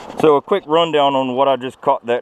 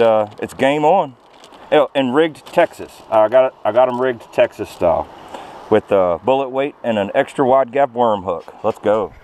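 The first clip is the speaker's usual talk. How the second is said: usual speaking voice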